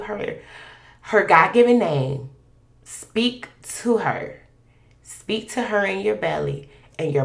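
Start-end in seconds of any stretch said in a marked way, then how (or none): none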